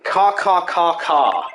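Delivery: loud cawing like a crow; crow caws. Acted. Possessed mayor announcing the yokai with loud crow caws. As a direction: caw out like a menacing bird spirit. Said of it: "I am Tenma Taro".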